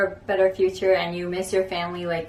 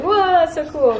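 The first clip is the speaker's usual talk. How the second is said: excited; 10 semitones higher, 5 dB louder than usual. Retold or imitated. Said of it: "I felt like".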